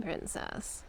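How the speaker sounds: ordinary talk, nothing marked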